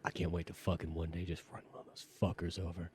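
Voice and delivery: low, hushed relish; dark daydream. Acted. Fantasy of running people over.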